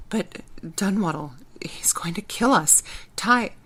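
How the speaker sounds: faint, fading whisper; weak whisper. Acted. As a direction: breathe it out as you fade. Weak, semi-conscious.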